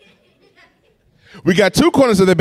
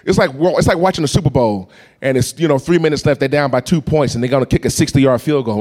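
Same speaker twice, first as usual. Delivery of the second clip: ordinary talk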